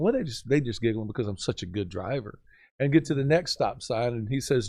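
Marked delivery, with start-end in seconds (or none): 0.0-2.4 softer, lower, musing to himself; quietly pleased with himself, under his breath; smug inner thought. Retold or imitated. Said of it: "I'm thinking".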